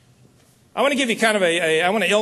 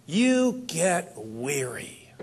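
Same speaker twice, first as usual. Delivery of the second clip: very slow, drawn-out and tired; slow weary; 5 dB quieter, much slower than usual. Acted. Speaker telling the audience about growing tired, slow and weary. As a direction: let each word sag with fatigue.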